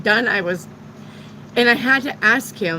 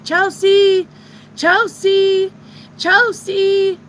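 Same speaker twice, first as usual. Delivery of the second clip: high, loud panicked calls for Chelsea; screaming a name; 10 semitones higher, 6 dB louder, much slower than usual. Retold or imitated. Lost in Walmart, calling for her daughter, panicked scream. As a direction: shout her name again and again, frantic. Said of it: "I started screaming for Chelsea".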